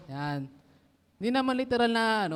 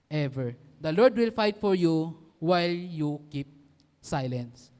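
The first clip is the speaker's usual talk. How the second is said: scripture reading; 3 semitones lower, slower than usual. Acted. Preacher reading Exodus to the youth, solemn and slow. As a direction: proclaim the verse to the congregation.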